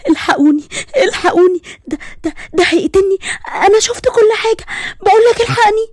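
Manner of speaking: high-pitched, stammering, tearful plea; terrified young woman. Acted. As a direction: beg for help in a panicked girl's voice. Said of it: "صوت بنت شابة بتقولي". (صوت بنت خايفة بتستنجد وهي بتعيط)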